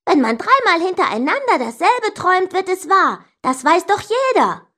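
high-pitched, stubborn argument; insistent. A narrator's reading. Sister insists dreams come true, high and adamant. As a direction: argue it hard in a high voice. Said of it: "beharrte sie".

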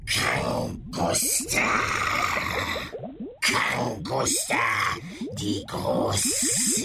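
slow, grand creature voice; monster voice. Acted. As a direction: proclaim it like a beast. Monster names itself.

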